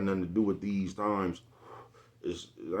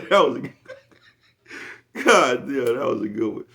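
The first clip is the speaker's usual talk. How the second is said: laughing through words; 4 semitones higher, 6 dB louder, much slower than usual. Real, not acted. Laughing at his own joke.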